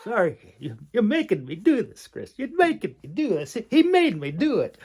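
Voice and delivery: high, loud mock accusation; hammed-up loud blame; playful protest. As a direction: pretend he forced you, laughing it off.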